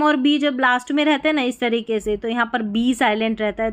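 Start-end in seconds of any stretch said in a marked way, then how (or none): none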